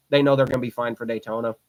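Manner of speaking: normal talking voice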